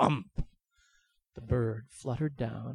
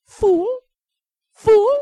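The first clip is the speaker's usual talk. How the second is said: shrill high shouting bird; high mocking squawk from the treetop; bird calling down; 17 dB louder than usual. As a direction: screech it down from the bush, much louder. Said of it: "called down".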